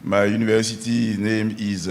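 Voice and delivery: ordinary delivery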